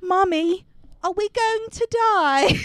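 put-on high, innocent British little-girl question; put-on British child's voice. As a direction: ask it as a small British child. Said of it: "I don't know why she's British".

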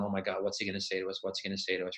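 low, hushed worried murmur; scared inner voice. Retold or imitated. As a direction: fret softly under your breath, retelling it. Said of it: "sitting there scared as hell".

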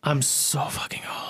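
drops to a whisper for the repeat; a hushed aside, half to himself. Real, not acted. To his podcast cohosts, moaning about his age, whispered.

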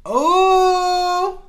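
high loud excited cry. Real, not acted. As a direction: shout it out. Excited exclamation.

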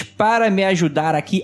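ordinary talk, nothing marked

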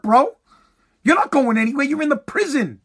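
usual speaking voice

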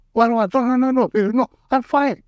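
high, protesting what, what; kid brushing them off. Retold or imitated. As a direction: wave off the fuss like a stubborn boy. Bleeding kid brushing everyone off.

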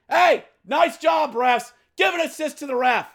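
high, sarcastic shout at the refs; sarcastic yelling. Acted. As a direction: yell mock praise at the referees. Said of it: "I am yelling".

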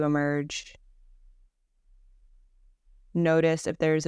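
calm, unhurried Yoga Nidra guide; guided meditation. Acted. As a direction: guide them gently into rest, with long pauses between phrases.

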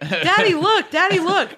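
put-on high, loud kid calling to Dad; excited kid. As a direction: mimic a child begging Dad to look. Voicing a kid showing a find.

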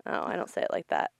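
ordinary delivery

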